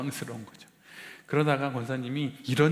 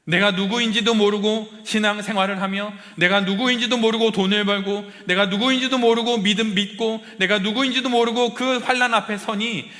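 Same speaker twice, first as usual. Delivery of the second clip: higher and louder, preaching at full force; impassioned preaching; 9 semitones higher, 8 dB louder than usual. Acted. Pastor pressing the congregation about who they are, impassioned preaching. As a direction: drive each line home like a rising sermon climax.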